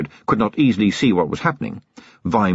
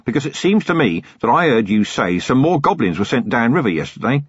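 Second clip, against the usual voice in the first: higher, eager country lad voice; a proud young rural copper, keen to please; eager young constable; 7 semitones higher than usual. Acted. Young rural constable Feeney speaks.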